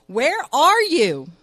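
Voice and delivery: slow, loud, high call. Acted. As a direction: call out searching. Husband searching, calling out.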